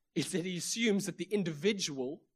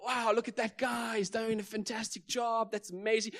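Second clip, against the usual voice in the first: higher-pitched, lightly hammed-up admiration; mock amazement; 5 semitones higher than usual. Preacher imitating onlookers admiring a show-off, mock amazement.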